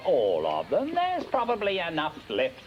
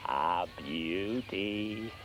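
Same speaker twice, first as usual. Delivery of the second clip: savouring; 6 semitones lower, 6 dB quieter, much slower than usual. Acted. Savouring repeat, slow soft.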